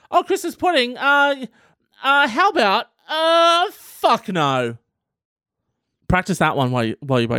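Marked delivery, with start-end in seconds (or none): 0.1-4.8 mock dithering refusal. Playing the refuser dithering before refusing pudding. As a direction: dither high, then flatly refuse.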